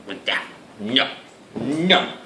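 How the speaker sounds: normal talking voice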